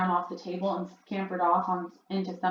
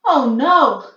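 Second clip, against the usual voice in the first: dismayed; 6 semitones higher, 13 dB louder than usual. A narrator's reading. Narrator horrified by mousetrap.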